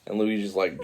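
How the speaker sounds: usual speaking voice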